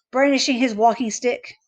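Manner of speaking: ordinary delivery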